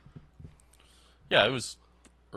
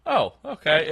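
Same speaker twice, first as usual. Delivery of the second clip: a loud, shrugging oh okay; shrugging acceptance; 6 semitones higher, 9 dB louder, slower than usual. Retold or imitated. Kids on TV shrug off a public execution, shrugging. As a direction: brush it off like it's nothing. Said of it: "everyone was kinda like".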